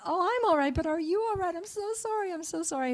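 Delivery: high, anxious voice of the apologizing driver; mock-flustered woman begging pardon; flustered apology. Retold apology after rear-ending truck.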